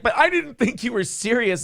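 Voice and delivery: high mock whine of disbelief; whiny girlfriend. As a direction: pitch up and plead like the girlfriend. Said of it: "And she's like".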